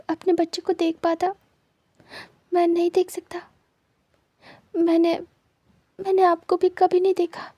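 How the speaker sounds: high, tearful voice near sobbing; grieving he can never see his baby. Acted. Blind Wing tells husband through tears, tearful. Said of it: "ऐसे रोते नहीं हैं".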